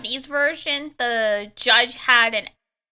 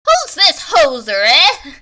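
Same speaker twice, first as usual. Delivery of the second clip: put-on loud Canadian judge accent with a punched "eh"; 4 semitones higher, 7 dB louder than usual. Canadian judge sizes up a newcomer in court, loud Canadian accent. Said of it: "I just nailed that perfectly".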